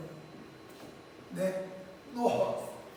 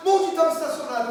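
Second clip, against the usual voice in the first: father barks the question, louder and higher; loud demand; 6 semitones higher, 10 dB louder than usual. Retold or imitated. Father grilling her about where the car was parked, loud. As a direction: demand the answer.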